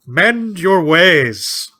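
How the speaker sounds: loud, high, drawn-out mock command; hammed-up stern order, each word stretched out. Imagined islander dismissing the captain, very stern. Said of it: "like, very stern".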